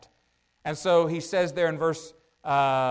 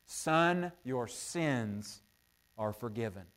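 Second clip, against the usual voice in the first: Son, your sins are forgiven said softly and lower, trailing away; tender, hushed; 7 semitones lower, 8 dB quieter, slower than usual. Retold or imitated. Preacher voicing Jesus comforting the paralyzed man, hushed and tender. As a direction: speak it gently, almost to himself. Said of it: "the first thing Jesus says".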